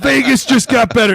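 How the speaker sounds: high, loud delighted cheer; gleeful boast. Retold or imitated. Teammate celebrating the fine money, high and loud with glee. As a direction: crow about the winnings. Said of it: "And you'd be like".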